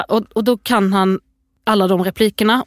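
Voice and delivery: ordinary delivery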